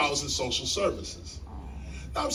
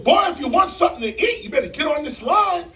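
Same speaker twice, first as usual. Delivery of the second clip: loud, higher and fast barked order; gruff shout; 10 semitones higher, 7 dB louder, faster than usual. Retold or imitated. Shelter man yelling at hungry young man, loud bark. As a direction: shout the newcomer onto the line. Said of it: "The man turned and he said".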